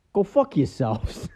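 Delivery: slow, smug punchline with a little smirk; deadpan smirking punchline. Retold or imitated. Detective in a car telling his colleagues a joke, smug and slow. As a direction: let it drop slowly with a sly grin. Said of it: "has a little smirk".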